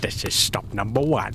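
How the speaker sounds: low sly Grinch voice; Grinch hissing. Acted. Grinch at the first house, low sly villain voice. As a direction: announce it like a sneaky thief. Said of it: "the old Grinchy claws hissed".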